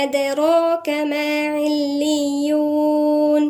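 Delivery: slow, melodic tajweed chant; measured Quranic recitation. Acted. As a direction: recite the verse slowly, drawing out the vowels. Recites the verse.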